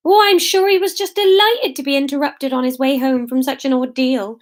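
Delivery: high, sarcastic sympathy; sneering. A narrator's reading. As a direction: start loud and high, dripping disdain.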